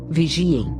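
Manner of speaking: ordinary delivery, nothing marked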